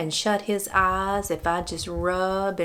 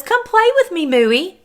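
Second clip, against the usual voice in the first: much higher, louder playful dog voice; voicing the dog; 9 semitones higher, 6 dB louder than usual. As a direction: speak for the dog, begging his friend to play. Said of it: "That's the way he says".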